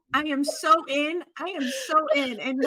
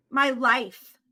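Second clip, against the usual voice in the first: ordinary delivery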